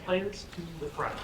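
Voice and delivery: quietly previewing the call; soft preview. Shown as a demonstration. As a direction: say it low, just a taste. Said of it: "it sounds like".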